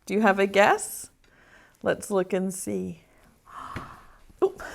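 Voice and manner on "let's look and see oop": a hushed, secretive whisper with a little gasp. Acted. Teacher revealing the tray to children, whispered with a gasp. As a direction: build suspense for the reveal.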